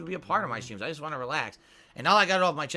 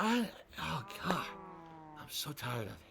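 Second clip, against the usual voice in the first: weary crying; 8 dB quieter than usual. Retold or imitated. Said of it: "I was like".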